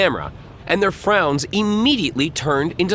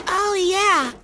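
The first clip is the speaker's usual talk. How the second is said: put-on much higher eager kid voice; little boy voice. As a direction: answer like an excited little boy. Boy answers reporter.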